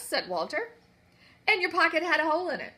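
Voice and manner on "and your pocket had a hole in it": rapid accusing. Acted. Queen interrogates quickly.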